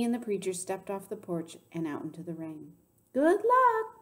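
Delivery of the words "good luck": high, loud singing-out call; very high carrying well-wish. A narrator's reading. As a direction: call it from another room. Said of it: "Miss Franny called from the kitchen".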